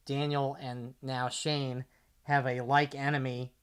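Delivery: ordinary delivery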